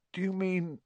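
high, hushed croak. A narrator's reading. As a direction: force out a dawning question. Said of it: "Harry croaked".

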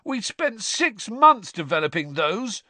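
high, loud protest; outraged son yelling in protest; indignant shout. A narrator's reading. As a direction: shout back at your mother. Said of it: "Fred shouted at his mother".

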